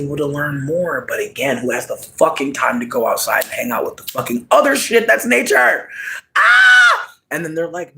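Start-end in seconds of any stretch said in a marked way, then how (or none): 6.4-7.2 loud shrill scream, pitch way up; piercing yell of exasperation; high exasperated scream. Real, not acted. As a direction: shriek in frustration.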